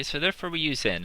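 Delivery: ordinary delivery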